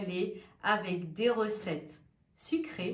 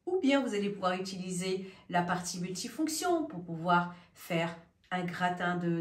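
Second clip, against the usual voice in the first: ordinary talk, nothing marked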